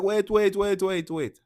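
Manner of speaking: high, loud, panicked repeated wait, fading down; flustered wait. Retold or imitated. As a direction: frantically stop a fast talker. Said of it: "And you're like".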